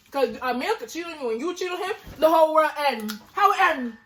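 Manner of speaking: loud and high outraged rant. Real, not acted. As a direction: throw the question up in disbelief, laughing.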